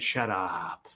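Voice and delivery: showing the doubters the check, quietly smug. Retold or imitated. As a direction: hold up the check and say it low.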